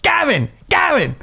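high shouted name, over and over; imitating a drunk woman screaming his name; drunk woman shouting. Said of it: "At one point".

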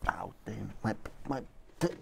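ordinary talk

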